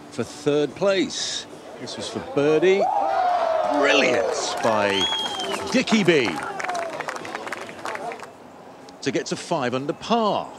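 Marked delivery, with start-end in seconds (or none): 1.8-10.5 excited call. Real, not acted. Commentator calls a birdie putt.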